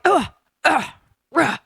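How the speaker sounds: imitating kicks and punches with grunts; mock fighting grunts. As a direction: grunt like in a brawl. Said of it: "of, like".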